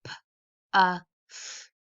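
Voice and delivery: P, uh, f said as separate sounds; sounds tapped out. Shown as a demonstration. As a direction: tap out each sound of puff. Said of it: "tap these words out".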